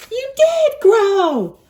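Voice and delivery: high, slow, amazed piggy; high excited pig voice; squeaky Piggy voice. Acted. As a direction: squeal it like a delighted little pig. Teacher voices Piggy in storybook.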